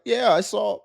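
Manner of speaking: high-pitched mock voice of an envious woman; whiny woman impression. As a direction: ham up an entitled complainer. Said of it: "she gonna be like".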